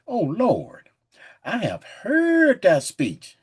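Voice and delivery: slow, weighty King James reading; measured, booming Bible reading; solemn scripture reading. Acted. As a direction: read it like a preacher at the pulpit. Reads scripture aloud.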